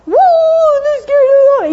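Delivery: high, loud whooping yap; excited little dog. Retold or imitated. Little dog yapping at a girl, high loud whoop. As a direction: yelp it out like a small dog. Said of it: "So little dogs going".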